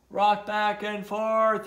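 high, slow, loud holler; drawn-out storybook shout; yelling. A narrator's reading. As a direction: yell each word up to someone stranded. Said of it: "yelled the turtles".

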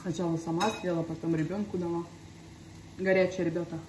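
talking between bites; chewing while talking. Real, not acted. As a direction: mouth partly full. Seen 0: Holds bitten toasted bread, chewing motions, bites again at 3.6.

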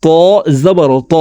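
ত said with a thick, full t; heavy letter. Shown as a demonstration. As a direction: make the t of ত heavy. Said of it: "ত হরফকে মোটাভাবে উচ্চারণ করে পড়তে হবে".

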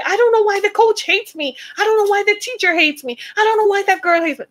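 put-on high, fast whiny voice of a teenage daughter; hurt daughter complaining. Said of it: "Don't your daughters come home and say".

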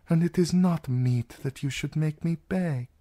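lightly voiced husky princess. A narrator's reading. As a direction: refuse to beg, a touch husky. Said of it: "she said in her husky voice".

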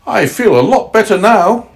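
high grandmother voice; relieved grandmother. Acted. Grandmother tucked up after rescue, high relieved voice. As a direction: pitch the old lady right up.

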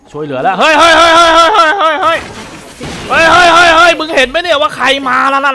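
loud, high string of hey hey shouts; alarmed yelling. Real, not acted. Gamer yelling to viewers about who just arrived, alarmed shouting. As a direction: holler the alarm at what just showed up.

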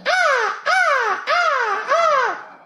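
high falsetto moans, hammed-up; mock moaning. Comedian imitating the porn actress, mock falsetto moans. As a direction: mimic her moaning in a squeaky falsetto. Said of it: "She's like".

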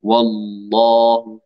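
والله slow and drawn, thick velarized lam, near sung; heavy lam. Shown as a demonstration. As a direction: swell the l of والله. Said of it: "لاحظوا كيف".

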